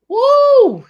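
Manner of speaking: high, loud "woo" of delight; excited whoop. Real, not acted. Host cheering her own hot take, loud high whoop. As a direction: let out a hype whoop.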